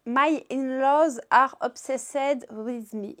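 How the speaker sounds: slow, careful English read with a French accent. Real, not acted. Showing viewers the webtoon title, slow French-accented English. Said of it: "Excusez-moi pour mon accent anglais".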